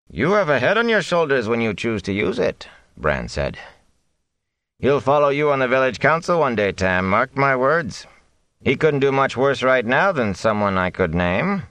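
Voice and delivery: mayor. A narrator's reading. Bran voiced.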